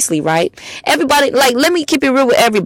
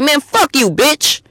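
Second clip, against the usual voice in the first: higher-pitched irritated clapback; imitated fed-up retort, pitched up; retold snapping back; 6 semitones higher, slower than usual. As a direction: act out snapping at someone. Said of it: "I had to snap on somebody".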